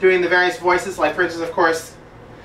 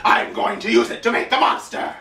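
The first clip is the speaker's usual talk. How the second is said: Lord Zed impression; 4 semitones higher than usual. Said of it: "it's like".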